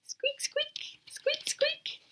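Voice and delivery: very high whispered squeak; tiny mouse peeps; high breathy squeaks. Acted. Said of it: "What sound does a mouse make?".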